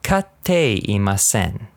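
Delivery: Katteimasen said very slowly, syllable by syllable; slow teaching model; slow careful demo. Shown as a demonstration. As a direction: spell Katteimasen out slowly for learners. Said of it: "let's do this".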